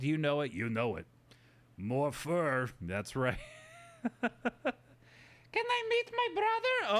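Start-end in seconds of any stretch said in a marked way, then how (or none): 5.5-7.0 squeaky high character voice; high little creature voice; high puppet voice. Acted. Puppet voice asks for brother.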